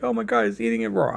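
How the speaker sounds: high, fast imitation of an alarmed viewer; hammed-up high-pitched alarm; mock shock. Mimics shocked viewers reacting to pink.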